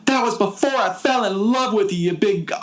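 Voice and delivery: high, hammed-up Southern-ish heroine; mock lovestruck girl. Heroine sweetly scolding her captor, in a high hammed-up voice. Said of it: "She's like".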